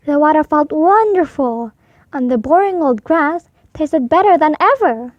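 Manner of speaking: usual speaking voice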